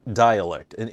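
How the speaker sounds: ordinary delivery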